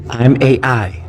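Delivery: light synthetic robot voice; a hint of an old speech synthesizer; computer voice. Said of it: "That's my computer voice".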